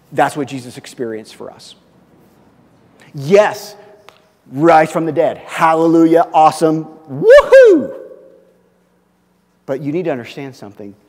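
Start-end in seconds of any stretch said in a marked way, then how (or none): 3.1-8.0 excited celebration. Real, not acted. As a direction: cheer it out to the congregation. Preacher celebrating resurrection.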